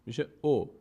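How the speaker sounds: ordinary talk, nothing marked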